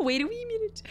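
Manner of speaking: high, laughing Scottish "Wait a wee minute"; Scottish accent with a laugh. Retold or imitated. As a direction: repeat it in a giggly Scottish accent. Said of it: "Embracing her Scottish accent".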